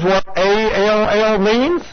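each letter of "A L L" said separately and slowly; spelled out. Shown as a demonstration. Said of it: "the word all".

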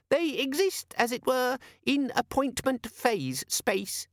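very high and slow, lecturing; pedantic squeaky demon. Acted. The demon lecturing Vimes on appointments, squeaky and slow. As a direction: spell it out like a fussy know-all.

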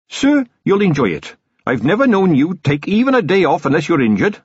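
high, keen voice to the commander; earnest young captain, encouraging. Acted.

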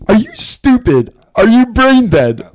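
high, loud and incredulous; exasperated shouting. Real, not acted. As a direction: yell it in disbelief. Heated argument about aunts.

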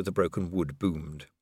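ordinary delivery, nothing marked

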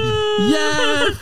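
tiny squeaky high yes. Retold or imitated. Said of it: "She's just like".

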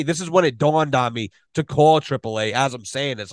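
ordinary talk

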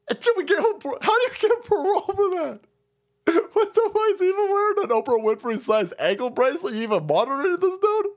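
high, incredulous mock shrieking; mock outraged squeal. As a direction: shriek it in fake disbelief. Mocking the outraged left.

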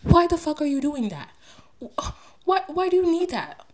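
sudden loud, high, incredulous outburst; exasperated outburst. Real, not acted. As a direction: fire off the question in disbelief. Exasperated rant at the royals.

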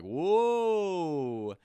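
loud, long, high crowd ooh; imitated crowd "whoa". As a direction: imitate a whole bar reacting with a drawn-out whoop. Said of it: "the whole bar after I said it was like".

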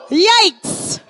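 sudden loud, high yelp; a startled comic shout; yikes. Real, not acted. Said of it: "reacting to the story".

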